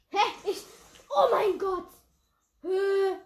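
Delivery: loud, high shocked outburst; shocked shout. Real, not acted. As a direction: yell in total disbelief. Gegnerin cheatet plötzlich. (schockierter Aufschrei)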